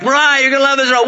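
high-pitched dad's hype; excited coaxing. Retold or imitated. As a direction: sell the ride to a reluctant kid. Dad hyping ride to daughter.

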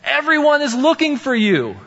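high, loud, urgent call; exclaiming. A narrator's reading. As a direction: cry out that everyone is searching. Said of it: "they exclaimed".

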